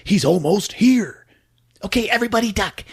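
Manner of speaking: high, urgent hushed-party voice; lookout's warning. Retold or imitated. Lookout warns party guests.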